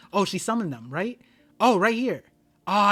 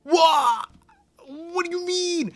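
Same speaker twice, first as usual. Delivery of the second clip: high, loud cries of disbelief; real high-pitched freak-out; shocked screaming; 10 semitones higher, 11 dB louder, slower than usual. Real, not acted. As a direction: shriek out your astonishment. Stunned by a manga reveal.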